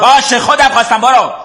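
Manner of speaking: high, irritated snapping; bad-tempered dismissal. Sleepy brother sending his sister off, angry and high-pitched. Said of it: "خیلی بد اخلاق شدی".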